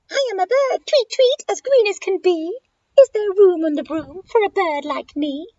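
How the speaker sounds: high, chirpy bird voice; high-pitched tweeting bird character; shrieking bird. Acted. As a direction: squeak it out like a little bird. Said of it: "with an ear-splitting shriek".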